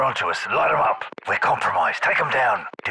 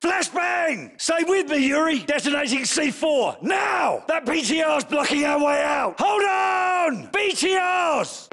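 shouted orders; 6 semitones higher, slower than usual. Acted. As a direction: bark commands over the gunfire. Firefight, yelling orders over gunfire.

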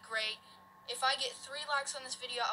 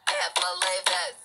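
frustrated yell; 5 semitones lower, 8 dB louder, slower than usual. Real, not acted. Repeats after losing.

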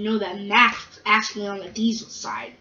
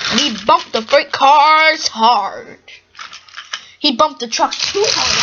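loud, higher-pitched climactic storytelling; excited narration; 4 semitones higher, 8 dB louder than usual. A narrator's reading. Narrator tells Gordon bumping the cars, excited. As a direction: shout the action, stretching the words.

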